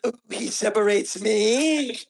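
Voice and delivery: breathy, slow mock sigh over a book; a lightly hammed-up swoon; mock swooning reader. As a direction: swoon at the line like she's hooked. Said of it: "how could she be like".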